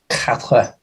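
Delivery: quatre said in French, soft final tr; French number. Pretend. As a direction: quatre in French, one syllable kat. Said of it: "if we're doing languages".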